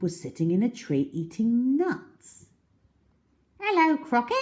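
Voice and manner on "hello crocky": very high, chirpy little monkey; high cheeky monkey greeting. Acted. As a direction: squeak a friendly hello up in the tree. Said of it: "said Mugglewump".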